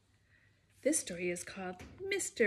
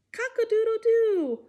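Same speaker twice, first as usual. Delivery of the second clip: high, loud rooster crow; full-throated cock-a-doodle-doo; 11 semitones higher, 9 dB louder than usual. Pretend. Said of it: "like a rooster".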